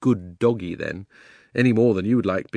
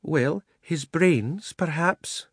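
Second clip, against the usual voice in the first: mild elephant reply; 7 semitones higher, much slower than usual. Acted. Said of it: "she replied mildly".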